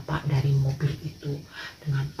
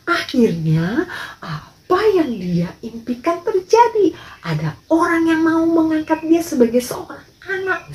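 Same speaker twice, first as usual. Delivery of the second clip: much higher, joyful storytelling; storyteller's voice soaring high with delight; excited narration; 11 semitones higher, 4 dB louder than usual. A narrator's reading. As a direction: tell it bursting with Tommy's joy. Narrating Tommy's joy.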